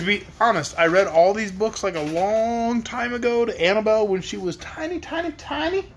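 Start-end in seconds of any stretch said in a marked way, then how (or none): none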